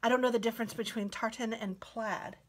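ordinary delivery

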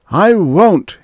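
high, loud defiant shout. A narrator's reading. Man defies the unseen voice, shouting. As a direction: shout the refusal. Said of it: "he shouted again".

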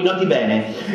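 plain reading voice

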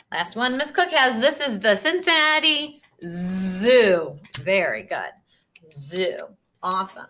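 ordinary talk, nothing marked

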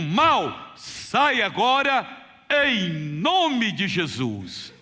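preaching at full force. Acted. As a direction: command the demon out, rising and stretching the last words. Rebukes the spirit of disease.

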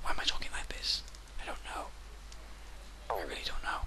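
soft unvoiced whisper. Real, not acted. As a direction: keep it a hushed whisper. Keeps whispering.

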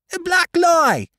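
high, loud shrieking cry; screaming boy. A narrator's reading. Furious cabin boy denying the charge, screaming high. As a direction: scream it in the boy's shrill voice. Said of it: "screamed the boy".

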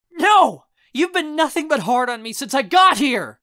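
high, loud outburst; furious shout. Acted. Raz rages at uncle, furious shout. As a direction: shout over the other person. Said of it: "I shout".

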